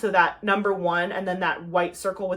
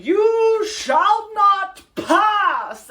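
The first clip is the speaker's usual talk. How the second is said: much higher and louder, very slow booming command; Gandalf; 11 semitones higher, 9 dB louder, much slower than usual. Retold or imitated. Retelling the movie scene, booming Gandalf impression. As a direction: boom it like the wizard on the bridge. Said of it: "So it's Gandalf saying".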